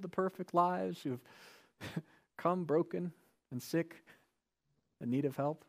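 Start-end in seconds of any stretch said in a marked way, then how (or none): none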